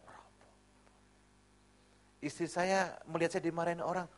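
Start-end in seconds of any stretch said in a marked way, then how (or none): 0.1-0.5 barely audible meek murmur; diam pasrah. Retold or imitated. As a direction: take the pinch meekly, almost under your breath. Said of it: "saya kan diam saja". (gumaman pasrah sangat pelan)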